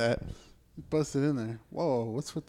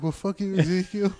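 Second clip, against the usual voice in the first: mock dog voice; 5 semitones higher, 4 dB louder than usual. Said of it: "it's like".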